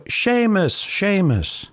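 high, singsong hail across a square; calling out. Acted. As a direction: call the name in a high, drawn-out voice. Priest hails them across the piazza.

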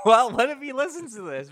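loud, high, laughing through the words. Real, not acted. Podcaster imagining the actor listening, laughing loud. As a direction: crack up while asking.

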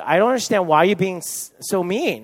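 loud, high, wounded protest; getting upset. Retold or imitated. Girlfriend argues with boyfriend on her birthday, upset. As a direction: plead upset, imitating a hurt girlfriend. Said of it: "beginning to get really upset".

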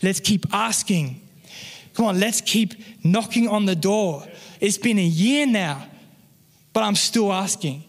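emphatic exhortation, separate phrases with pauses; rally the congregation, slowing and rising in pitch toward the end; impassioned preaching. Real, not acted. Pastor urging church not to give up praying, impassioned preaching.

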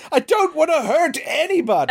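very high, hammed-up plea of innocence; mock frantic protest. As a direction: imitate a doctor squealing his excuse. Exaggerates doctor's protest, co-host laughs.

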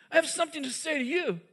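insist, voice lifted, on being heard. Retold or imitated. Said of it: "he says to Simon".

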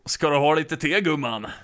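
in Swedish. Shown as a demonstration. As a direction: offer tea in Swedish. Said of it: "What about Swedish?".

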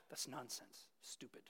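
quietly dismissive sneer; dismissive scoff. Retold or imitated. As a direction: brush it off as stupid. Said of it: "I mean it not like".

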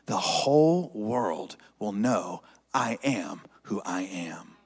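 hushed, breathy whisper of Jesus' words. Acted. Pastor voicing Jesus to the disciples, whispered. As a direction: drop to a secret whisper.

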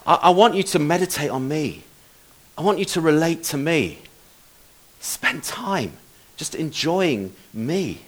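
softly spoken personal invitation; God's gentle appeal. Acted. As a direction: say it gently, with space between phrases. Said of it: "what God has been challenging him".

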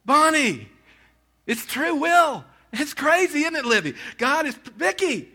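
an overjoyed pastor singling out his flock; ecstatic calling out. Real, not acted. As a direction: call each name out in joyful disbelief. Calling out congregants by name.

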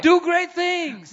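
high, loud, fiery preaching; preaching at full force. Acted. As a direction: rouse the congregation. Preaching climax.

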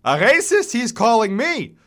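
put-on high, loud, reedy old man's outcry; accented old man crying out in indignation; indignant cry. A narrator's reading. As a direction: protest to the crowd, wounded and outraged. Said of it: "cries Meyer".

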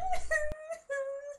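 high teary laugh; laughing until it turns to crying. Real, not acted. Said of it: "It's me cry singing".

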